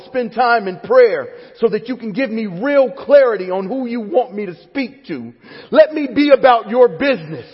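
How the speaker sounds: high, loud fervent prayer; full-force pulpit plea; impassioned preaching. Real, not acted. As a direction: cry out your surrender to God.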